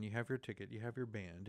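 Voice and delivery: ordinary talk, nothing marked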